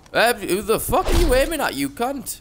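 high, hammed-up outrage at the gunman; mock threat. Streamer scolding an NPC who aims at him, mock angry. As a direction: snarl a cartoon threat.

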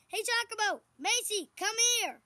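loud, high call across the field; shouting for Macy and Giacomo to come. Real, not acted. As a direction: call the horses over from afar. Calling horses over.

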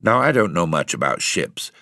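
ordinary delivery, nothing marked

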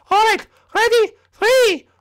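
very high cartoon announcer voice; high-pitched official countdown; squeaky announcer. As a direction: count down in a tiny, piercing voice. High figure counts down round.

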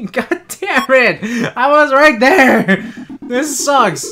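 slow, high, laughing frustrated yell; frustrated shouting. Real, not acted. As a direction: let it burst out through laughter. Angry after missing the shot.

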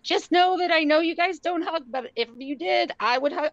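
lightly voiced breathless gush; eager pleading. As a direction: beg for a hug you won't take. Hugger begging Seattle nurse.

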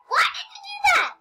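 high laughing yell, fast; laughing shout. Real, not acted. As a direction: blurt it out laughing.

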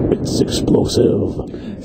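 whispery, slow trailer voice; soft, voiceless announcer growl; movie voiceover. Pretend. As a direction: draw it out in a menacing whisper. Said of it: "Rindle can do the full voice".

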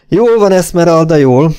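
high, loud, firm calming; insistent reassurance. A narrator's reading. Clayton to hysterical Esmeralda, loudly insistent. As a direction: talk over someone's hysterics.

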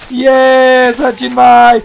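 stretched, drawn-out call to a friend. Real, not acted. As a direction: call his name out long. Calling friend on arrival.

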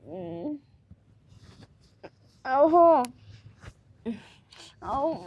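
ordinary delivery

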